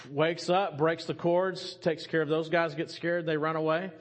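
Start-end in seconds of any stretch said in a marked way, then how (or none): none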